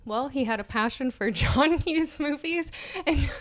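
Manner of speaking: usual speaking voice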